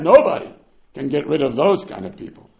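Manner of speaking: usual speaking voice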